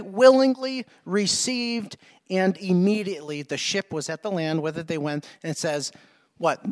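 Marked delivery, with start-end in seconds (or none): 0.0-2.0 "Willingly received" said slow, each word weighted; slow emphatic key words. Shown as a demonstration. Announcing the key words to the congregation, slow and weighty. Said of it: "three words, ready?".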